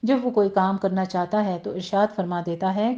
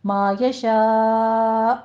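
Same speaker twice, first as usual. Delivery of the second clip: slow, drawn-out chanted recitation ending in a light stop; Quran recitation; 4 dB louder, much slower than usual. Shown as a demonstration. Teacher demonstrating tajweed to students, slow recitation. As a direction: chant it slowly, then cut off gently. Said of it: "یہاں پہ آپ نے جھٹکے سے وقف کرنا ہے".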